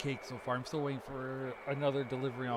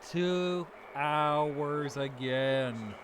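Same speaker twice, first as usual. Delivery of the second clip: drawn-out complaint; much slower than usual. Real, not acted. Complaining about long wait.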